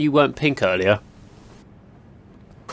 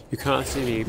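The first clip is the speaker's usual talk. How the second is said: "You can't see me" dropped much softer; softer hiding murmur of "You can't see me"; hushed; 6 dB quieter than usual. Shown as a demonstration. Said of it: "just play it slow".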